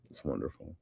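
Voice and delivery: low, soft "It's wonderful"; quiet aside. Real, not acted. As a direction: say it softly and low, almost to yourself. Repeats own praise, first softly.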